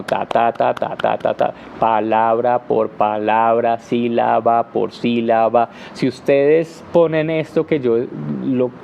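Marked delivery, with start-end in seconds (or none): 0.0-1.5 even, separate ta syllables, a little lower; choppy Spanish-style ta ta ta rhythm; syllable-timed rhythm. Shown as a demonstration. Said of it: "un ritmo en el lenguaje que es como". 1.8-5.7 slow and choppy, each syllable apart; measured syllable-timed delivery. Shown as a demonstration.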